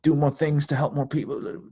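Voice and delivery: lightly voiced nagging inner ego; ego's voice. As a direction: voice the anxious ego urging you on. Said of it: "it's my ego going".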